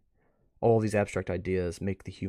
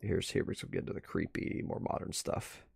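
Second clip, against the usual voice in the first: creepy whisper; 4 semitones lower, 8 dB quieter than usual. Real, not acted. Leading viewers into the creepy art, hushed. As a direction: lower the voice, build a spooky mood.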